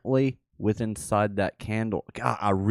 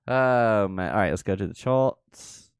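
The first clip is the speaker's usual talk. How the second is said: usual speaking voice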